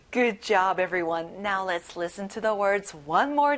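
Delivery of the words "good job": ordinary delivery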